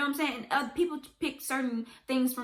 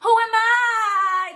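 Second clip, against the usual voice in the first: high, slow, loud wail; dramatic cry; 9 semitones higher, 8 dB louder, much slower than usual. Real, not acted. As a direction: wail it out to the sky. Rhetorical cry, dramatic repeat.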